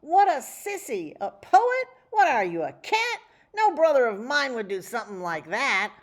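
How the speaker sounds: loud, high mocking taunt; jeering brothers. A narrator's reading. Brothers taunt Stanza for being a poet, loud high jeering. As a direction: crow it out like a bully brother.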